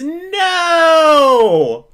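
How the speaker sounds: loud, high cry of despair; anguished shout. Real, not acted. Fan hearing the penalty won't count, loud and anguished. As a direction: wail the no in disbelief.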